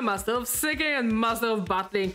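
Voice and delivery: showy host with high swooping openers; big character voice. Acted. Gym leader praises the challenger, big showy voice. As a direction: stretch key words like a flamboyant game host. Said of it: "Doing her voice hurts my throat".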